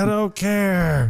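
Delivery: high, drawn-out holler in imitation; mock drunken yell. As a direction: stretch it out like a drunk yelling from a ledge. Drunk jumper yelling back, imitated.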